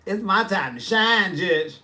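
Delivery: normal talking voice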